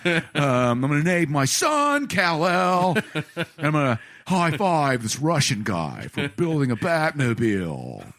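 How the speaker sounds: slow, lightly voiced movie star; a hint of Nicolas Cage, drawn out; mock Cage talking about taxes. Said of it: "Nicolas Cage ... decided".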